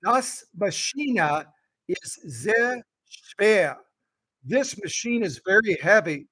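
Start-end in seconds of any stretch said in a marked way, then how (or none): 0.0-3.8 slow, deliberate German pronunciation. Shown as a demonstration. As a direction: sound out each word slowly. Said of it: "just so you know how to say it".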